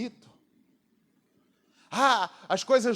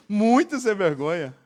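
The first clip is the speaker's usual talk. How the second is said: laughing; 3 dB louder than usual. Real, not acted. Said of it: "laughing at own story".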